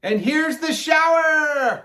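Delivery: high shout, slowly drawn out. A narrator's reading. Alkaloo arrives with the hose, shouting. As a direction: draw out the last word in a high shout. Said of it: "he shouted".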